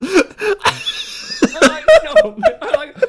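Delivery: high, loud laughing "No"; laughing hard through the answer. Real, not acted. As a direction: burst out laughing on the no.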